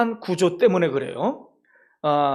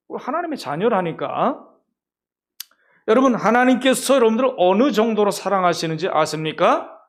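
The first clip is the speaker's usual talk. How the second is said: ordinary talk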